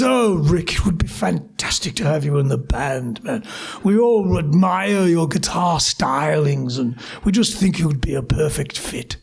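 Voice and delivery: low-pitched, plummy English accent impression. English bassist inviting [name] into the band, plummy English accent. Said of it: "really, really plummy sort of English accent".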